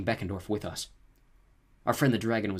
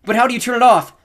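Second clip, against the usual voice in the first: high, loud desperate question; panicked shout; frantic shout; 15 dB louder than usual. A narrator's reading. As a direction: yell while fleeing. Shouting while chased by dragon.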